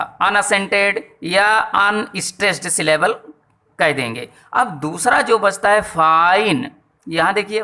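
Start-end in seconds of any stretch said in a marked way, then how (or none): 5.8-6.8 fine stretched long and leaned on; stressed, drawn-out syllable. Shown as a demonstration. Teacher showing the stressed syllable, long emphatic fine. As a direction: pull fine out with weight on the vowel. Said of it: "खींच के कहना पड़ रहा है".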